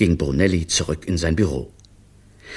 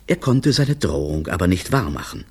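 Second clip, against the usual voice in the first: ordinary delivery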